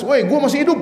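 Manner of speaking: imitated high-pitched shout back; impatient retort. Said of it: "Umar ini udah nggak sabar".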